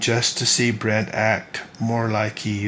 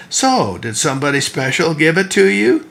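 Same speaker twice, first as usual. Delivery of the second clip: raised, louder playful question; teasing, silly grin; 6 semitones higher, 6 dB louder than usual. A narrator's reading. Sister Brimley teases Ben about his tie, mildly silly and teasing. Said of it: "reiterated with a silly grin".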